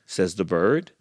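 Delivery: ordinary delivery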